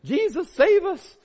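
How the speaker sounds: high, loud, drawn-out cry for help; hammed-up panicked plea; mock panic. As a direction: cry out like panicking men in a storm. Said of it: "they're all panicking".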